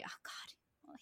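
breathless awed whisper. Real, not acted. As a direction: whisper it under your breath. Gushing about a moving episode.

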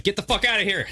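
high-pitched retold angry dismissal; high mock yell without extra volume; mock angry order. Acts out camera guy shooing them.

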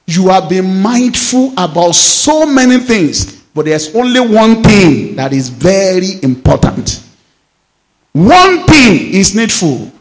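preacher voicing Jesus to Martha, slow and solemn; solemn scripture quote. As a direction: weigh each word slowly.

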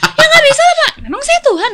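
high, very loud re-enacted outburst; angry retort. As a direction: hurl it back at the patient, exasperated. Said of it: "gue baik marah sampai yang satu klinik". (menirukan balasan marah dengan suara tinggi)

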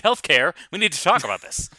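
loud, earnest outburst; suddenly fired up and loud; impassioned Jimmy Kimmel. Retold or imitated. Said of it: "then he's like".

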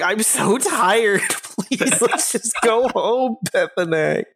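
high, drawn-out whine of tiredness; worn-out begging, played up; exhausted pleading. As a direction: plead wearily, pitched well up. Weary plea to stubborn companion, acted.

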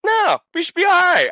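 high, loud yell of reassurance; shouted mid-fall; mock shout. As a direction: belt it out like a cocky promise. Retold bump reassurance, shouted.

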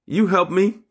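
put-on high little-child voice, rising at the end; Stormy. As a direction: imitate a small granddaughter asking for help. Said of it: "I heard my granddaughter Stormy say".

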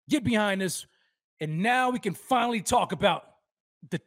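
ordinary talk, nothing marked

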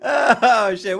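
loud, high startled cry. Real, not acted. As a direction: jump as the stream starts. Startled on going live.

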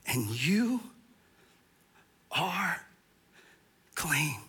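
soft, breathy whispered reassurance; gentle whisper of assurance; hushed whisper. Acted. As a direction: whisper it like a secret comfort. Jesus' words whispered for emphasis.

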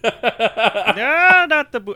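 high, loud hammed-up wail; mock horror. Host as doomed pirate facing boo box, mock-horrified cry. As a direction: plead in exaggerated dread. Voicing the condemned pirate's plea.

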